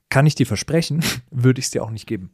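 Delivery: ordinary talk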